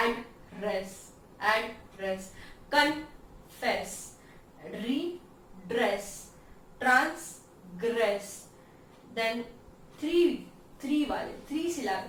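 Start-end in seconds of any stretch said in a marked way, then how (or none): 0.1-8.5 each word slowly split in two, as in confess as con-fess; syllable by syllable. Shown as a demonstration. Teacher showing two-syllable words, syllable by syllable. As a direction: pause between the two beats of redress and transgress. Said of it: "दो सिलाबे वाले".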